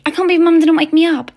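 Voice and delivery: loud, high-pitched complaint; exasperated outburst. Acted. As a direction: complain loudly in disbelief. Acted dismay at not being woken.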